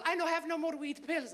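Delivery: put-on high accented complaint; high-pitched mock-mother voice; immigrant mother. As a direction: report it as a needy immigrant mom. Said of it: "she called me and she goes".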